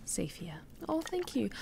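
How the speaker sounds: barely voiced hush; hushed whisper of relief. Real, not acted. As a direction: keep it to a breath, unvoiced. Hushed in-game safe room.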